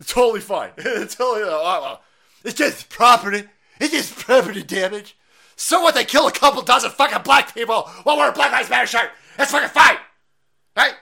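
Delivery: loud, high sarcastic rant; mock approval, yelled; sarcastic outrage. Real, not acted. Ranting at critics, loud and high in sarcasm.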